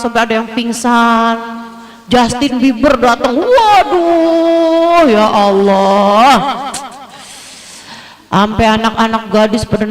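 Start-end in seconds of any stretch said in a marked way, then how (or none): 3.4-6.3 mock swooning gasp, slowed; swooning fan. Preacher mimics fans at a [name] show: swooning gasp. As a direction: gush like a starstruck fan.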